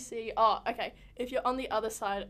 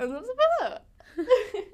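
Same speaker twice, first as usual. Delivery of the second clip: mock grand finish; 9 semitones higher, 9 dB louder, slower than usual. Playful wrap-up.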